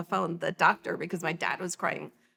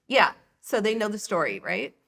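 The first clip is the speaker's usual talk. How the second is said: ordinary talk, nothing marked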